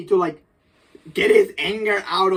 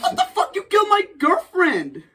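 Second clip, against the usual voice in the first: much higher outraged cry; indignant yell; 10 semitones higher than usual. Retold or imitated. Spider-Man yelling at Norman about his girlfriend, high outrage. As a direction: shriek back in disbelief.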